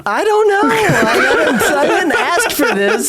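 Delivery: high-pitched laughing self-defence; playful protest. Real, not acted. Co-host teased about hot takes, high playful protest.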